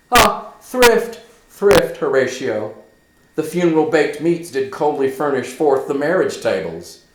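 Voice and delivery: high, loud bitter sarcasm; sarcastic, bitter. Acted. Hamlet mocking his mother's hasty marriage, bitter sarcasm. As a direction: spit out the word thrift. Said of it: "a ton of sarcasm and a ton of bitterness".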